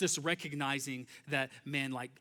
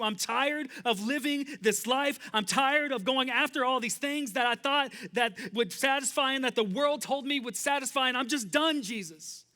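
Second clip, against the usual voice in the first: loud, raised pleading prayer of a broken man; desperate sinner's cry, louder and higher; crying out to God; 10 semitones higher, 9 dB louder than usual. Acted. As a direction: beg God out loud, voice lifted high. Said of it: "as he's crying out to God".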